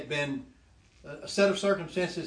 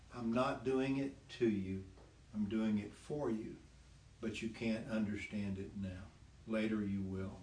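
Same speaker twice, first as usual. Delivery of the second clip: soft, low and gentle, like a loving father; 5 semitones lower, 8 dB quieter than usual. Retold or imitated. A loving father gently answering his angry son, soft and low. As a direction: speak it softly and tenderly. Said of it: "my loving, gentle father immediately answered".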